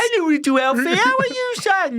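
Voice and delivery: put-on very high cooing baby talk. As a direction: coo at a grown son like a baby. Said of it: "still getting there".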